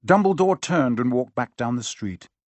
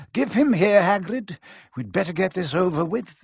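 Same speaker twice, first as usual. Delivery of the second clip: put-on higher, whimsical old wizard; lightly voiced kindly headmaster; Dumbledore voice; 6 semitones higher than usual. A narrator's reading. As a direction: speak with dry wise humour.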